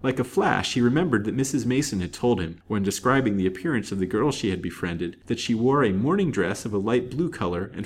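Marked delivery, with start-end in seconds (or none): none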